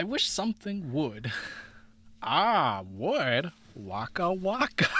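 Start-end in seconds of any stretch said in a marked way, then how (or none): 2.2-4.9 Fozzie Bear. Pretend. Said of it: "that Fozzie Bear".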